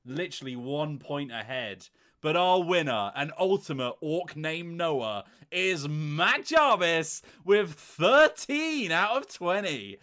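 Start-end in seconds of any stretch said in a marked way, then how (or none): 2.2-10.0 excited announcement. Acted. Announcing the quiz winner.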